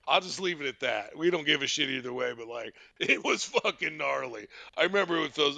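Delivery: normal talking voice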